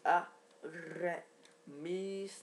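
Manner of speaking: drawn-out wrestler introduction, syllables stretched; slow, showy build on the name; ring-announcer style. Pretend. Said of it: "j'aime bien le dire comme ça".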